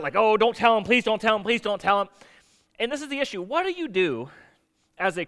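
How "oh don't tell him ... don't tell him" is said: mock-panicked pleading, pitched up and loud; acted frantic begging; freaking out. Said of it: "Onesimus is probably freaking out".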